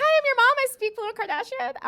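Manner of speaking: high, loud greeting to a newborn; mock introduction. Introducing herself to her newborn.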